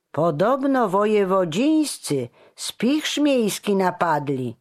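high, loud rumour-telling; agitated gossip. A narrator's reading. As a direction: pass on scandalous news to a neighbour. Townsman spreads indignant rumour to neighbour.

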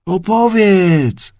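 slow, high, drawn-out call of many voices; called in chorus. A narrator's reading. Grooms eagerly beg Sindbad for his tale, drawn out. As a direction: stretch it out like a crowd answering together. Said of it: "zawołali wszyscy chórem".

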